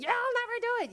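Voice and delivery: high taunting liar; high mocking inner liar. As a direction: sneer it like the doubting liar. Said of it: "a liar in our head".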